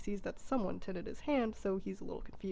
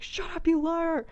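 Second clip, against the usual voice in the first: loud, higher, slow yell of rage; furious shout; 8 semitones higher, 8 dB louder, slower than usual. Retold or imitated. Turin yelling at Brandir, loud angry shout. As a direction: bellow it at the liar. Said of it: "start yelling at each other".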